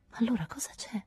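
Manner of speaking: high, soft worried whisper; anxious whisper. A narrator's reading. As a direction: breathe the question, afraid of the answer. Anxious plea to silent lover. (sussurro ansioso)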